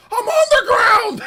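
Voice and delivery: high loud desperate cry; panicked wail. Retold or imitated. Streamer pleading with the cop, high loud wail. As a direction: wail it, terrified on the floor.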